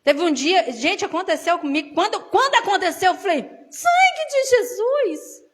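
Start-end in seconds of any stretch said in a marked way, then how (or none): 3.4-5.4 high, slow drawn-out gasp of shock; startled exclamation. Retold or imitated. Preacher recalling her shocked cry to God. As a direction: cry it out like a sudden fright.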